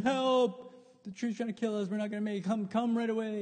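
high, loud, hammed-up panic; comic terror on the phone; mock frantic plea. Frantic call to tree doctor.

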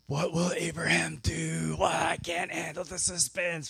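hammed-up audience hanging on every twist; gripped listeners. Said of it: "listeners at this point are like".

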